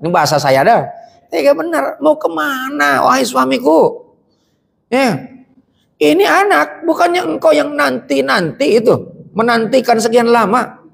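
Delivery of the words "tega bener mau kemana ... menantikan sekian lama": high, pleading plea to a departing husband; a wife begging her husband not to leave. Acted. Hajar pleading as Ibrahim walks away, high and anguished. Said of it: "Itu Nabi Allah ditanya begitu".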